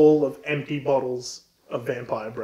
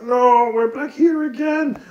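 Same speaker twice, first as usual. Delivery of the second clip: high, slow, loud mock despair; dismayed count; 9 semitones higher, 5 dB louder, slower than usual. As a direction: ham up the villain's dismay. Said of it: "Count's like".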